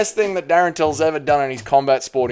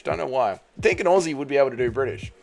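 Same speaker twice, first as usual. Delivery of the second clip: usual speaking voice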